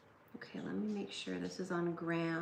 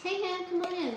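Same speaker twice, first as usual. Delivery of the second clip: calling the dog; 10 semitones higher, 4 dB louder than usual. Real, not acted. Calling dog to come inside.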